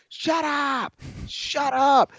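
high-pitched mock shout, no extra volume; imitated coach yelling. Imagined coach reacting to a slur, mock yelling. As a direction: pretend to yell like an outraged coach. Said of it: "maybe a coach would be like".

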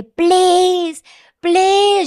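high, loud begging cry of please; desperate pleading yell. Retold or imitated. In surgery, begging staff, loud high pleading. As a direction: beg at the top of your voice. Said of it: "yo gritaba así de".

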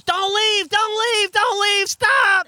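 high, loud mock yell of don't leave; shouting after them to stop. Post office guy shouting at them to stay, loud. Postal worker chasing them, yelling.

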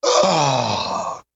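long pained groan; frustrated groan. Real, not acted. On-air frustration with static.

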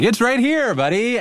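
chirpy high voice for helpful directions; high mock local. Man imitating the scammer's fake tourist, cheery and high. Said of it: "be like".

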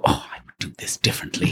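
hushed, whispered aside to himself; soft, voiceless daydreaming murmur; private musing. Acted. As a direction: whisper it like a secret thought. Voicing private thought on set.

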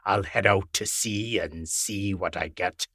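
put-on steady old fisherman, low; Captain Mike. A narrator's reading. Captain Mike promising to head out to sea, low voice. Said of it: "he said".

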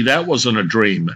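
ordinary delivery

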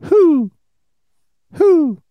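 high hooting owl call. Retold or imitated. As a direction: hoot like a cartoon owl. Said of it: "none of them were".

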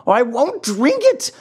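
high, wailing puppet protest; bursting into tears. A narrator's reading. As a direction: cry out in childish refusal. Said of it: "bursting out crying".

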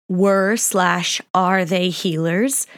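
normal talking voice

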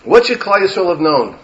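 usual speaking voice

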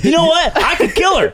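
high-pitched, mock-obliging offer; over-eager friend. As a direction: play a desperately accommodating pal.

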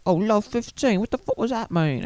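high, far-off Cockney-ish put-on voice; poor Brit accent. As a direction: ham up a confused working-class Brit. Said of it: "that was a good, like, poor Brit".

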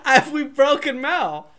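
words breaking into laughter; can barely speak for laughing; laughing hard. Real, not acted.

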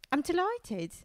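high, mock British delight; humbly thrilled British winner, hammed up. British baker reacting to winning, mock overwhelmed delight. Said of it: "They're literally like".

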